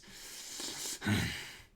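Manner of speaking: a long unvoiced villain hiss; hissing sigh. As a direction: breathe out a menacing hiss.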